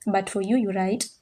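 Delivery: ordinary talk, nothing marked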